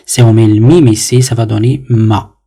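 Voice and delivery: normal talking voice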